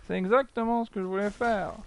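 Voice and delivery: a hint of a cheerful cartoon stuntman quoting his catchphrase; Pouf le cascadeur. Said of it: "c'était Pouf le cascadeur qui disait ça".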